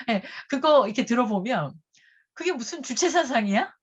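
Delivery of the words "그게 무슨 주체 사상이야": incredulous mock question. Real, not acted. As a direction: scoff at the idea, amused. Mocking the statement's tone.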